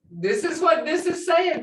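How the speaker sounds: ordinary talk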